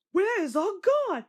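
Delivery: frightened coaches crying out. A narrator's reading. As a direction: wail it twice, rising in panic. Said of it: "they cried".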